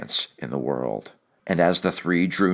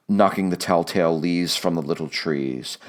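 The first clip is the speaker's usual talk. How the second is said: ordinary delivery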